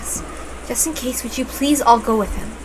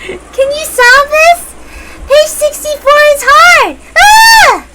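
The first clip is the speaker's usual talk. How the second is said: put-on very high, loud childish squealing; a little girl butting in eagerly; childlike outbursts; 8 dB louder, much slower than usual. Maria interrupting the grown-ups in a shrill child voice.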